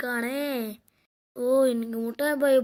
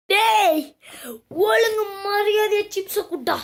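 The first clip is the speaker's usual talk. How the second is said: louder, higher furious demand; angry threat; 5 semitones higher, 3 dB louder than usual. Acted. Boy threatens friend to hand over chips, furious. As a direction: bark the order at him.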